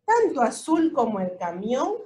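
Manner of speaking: usual speaking voice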